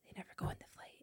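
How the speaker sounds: hushed whisper, no voice at all. Real, not acted. Telling friends the sad ending in a whisper. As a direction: drop to a whisper for the twist. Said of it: "whispered reveal".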